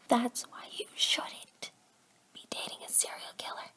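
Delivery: hushed unvoiced aside; whispered aside. Real, not acted. Reader whispering a warning to herself. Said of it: "aside whisper".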